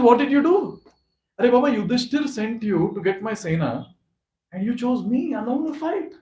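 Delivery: high-pitched, exasperated telling-off; playful acted reproach; mock scolding. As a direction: scold a friend in disbelief, voice climbing high. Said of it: "Krishna scolded Arjuna".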